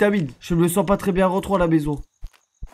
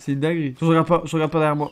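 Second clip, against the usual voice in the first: ordinary delivery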